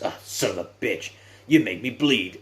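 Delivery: mildly hammed-up anger at being hurt; retold angry outburst. Retelling wrestler cut during fight.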